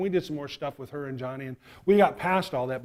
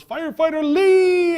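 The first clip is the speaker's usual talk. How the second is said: long, high, very loud holler; little boy hollering in delight; excited child's yell; 13 dB louder, slower than usual. Acted. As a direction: yell it down a long aisle, stretching the name. Boy yells across store aisle.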